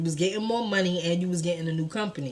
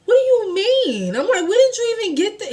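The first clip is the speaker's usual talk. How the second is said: high, loud, overwhelmed questioning; imitated astonished questioning; retold disbelief; 12 semitones higher, 6 dB louder than usual. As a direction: plead for an explanation, voice way up. Retold tearful disbelief at gift.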